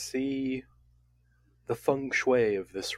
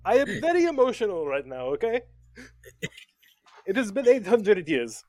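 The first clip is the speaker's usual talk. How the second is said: put-on French accent; 7 semitones higher than usual. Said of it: "you hear his voice crack".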